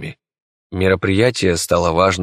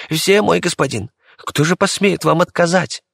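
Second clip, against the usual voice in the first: high, lightly fawning courtier; fawning servant; 11 semitones higher than usual. Acted. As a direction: flatter your master. Помощник льстит императору. (льстивый слуга)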